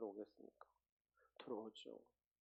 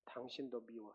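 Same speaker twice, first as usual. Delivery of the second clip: mock grudge; 2 semitones lower, 8 dB quieter than usual. Shown as a demonstration. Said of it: "옆 사람 봐보세요".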